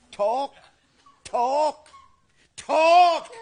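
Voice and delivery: demanding shout building to a yell; escalating shout. Acted. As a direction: bark the word harder each time till it's a scream. Grandfather yelling at baby.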